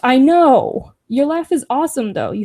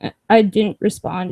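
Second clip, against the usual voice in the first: usual speaking voice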